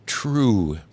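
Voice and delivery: TRUE stretched out long; elongated. A narrator's reading. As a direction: hold the vowel in "True". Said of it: "an elongated".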